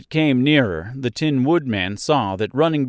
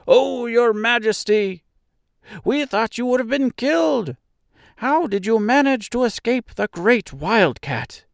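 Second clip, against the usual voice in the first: high-pitched little mouse voice; exclaiming mice; 11 semitones higher than usual. A narrator's reading. Mice greet the queen they thought killed, high exclaiming voice. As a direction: exclaim as an anxious subject mouse. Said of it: "they exclaimed".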